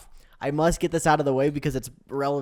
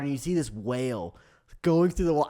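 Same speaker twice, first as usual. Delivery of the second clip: ordinary talk, nothing marked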